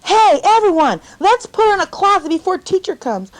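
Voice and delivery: high, loud gleeful call to the gang; rally the kids, loud and eager; schoolboy shouting a cruel plan; excited bully shout. A narrator's reading. Boy rallies others to lock her.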